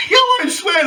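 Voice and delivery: high, loud laughing oath. Real, not acted. Laughing as he swears the story is true.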